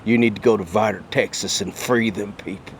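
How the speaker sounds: God as a brisk Texan; God handing down orders in Texan; Texas accent. Acted. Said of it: "God has a Texas accent".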